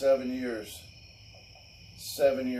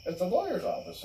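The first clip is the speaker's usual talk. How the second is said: much higher, fast put-on girlish voice; mock girlfriend; 11 semitones higher, faster than usual. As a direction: mimic her quick suspicious question. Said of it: "she's like".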